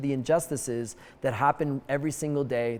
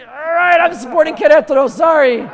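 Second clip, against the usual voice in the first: much higher and louder, rushed backpedal with a laugh; flustered fan; 13 semitones higher, 14 dB louder than usual. Pretend. Fan quickly corrects himself to the guard, loud high and rushed. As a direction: scramble to fix the answer, laughing. Fan hastily switches team.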